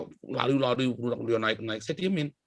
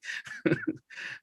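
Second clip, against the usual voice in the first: airy laugh; 4 dB quieter than usual. Real, not acted. Laughs at the story.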